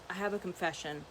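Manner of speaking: softly, gravely: I have a confession; low, hushed, serious confession; quiet confession. Retold or imitated. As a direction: hush it like a serious secret. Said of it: "he gets really quiet".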